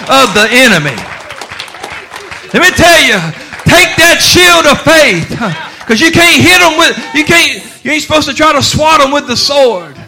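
loud, soaring preacher's cry; thundering pulpit call; full-force preaching. Acted. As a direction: preach it out to the back pews.

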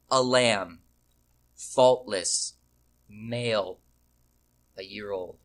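one weighty beat at a time, long pauses between; slow it down, each phrase landing alone, fading at the end; slow drumbeat. Shown as a demonstration. Preacher showing how the Hebrew reads, slow drumbeat phrasing. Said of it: "Just one drumbeat after another".

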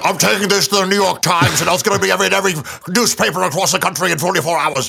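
a lightly hammed crusading reporter; mock reporter quote. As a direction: announce the scoop with mock bravado. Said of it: "So he's like".

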